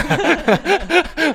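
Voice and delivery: high, bursting laugh at her own joke; burst of laughter. Real, not acted. Laughing at her own joke about being cheated on love.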